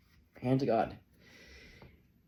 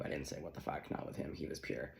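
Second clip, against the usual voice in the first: muttered aside; 8 semitones lower, 11 dB quieter than usual. Real, not acted. Quick under-breath aside.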